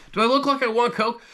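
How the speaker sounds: high, sarcastic squeal of disbelief; mock-outraged high whine; incredulous retort. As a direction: scoff at him in a mocking high pitch. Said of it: "I'm like".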